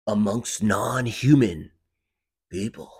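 slow, dramatic trailer phrasing; movie trailer voice. Acted. Trailer guy describes the creatures, in movie trailer voice. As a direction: hold the line, pause before the last word. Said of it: "The movie trailer voice?".

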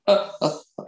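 mock laugh in separate ha's; imitated man's laugh. Retelling a man's voice laughing from the basement, imitated laugh. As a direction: carry on a put-on ha-ha laugh. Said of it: "he was laughing like".

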